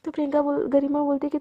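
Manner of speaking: plain reading voice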